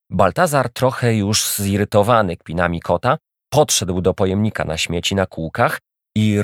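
usual speaking voice

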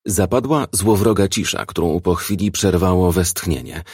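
plain reading voice

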